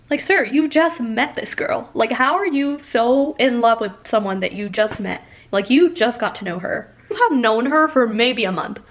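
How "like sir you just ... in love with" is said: sassy scolding. Real, not acted. Scolding a book character.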